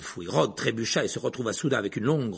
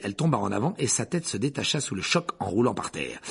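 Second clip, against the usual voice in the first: usual speaking voice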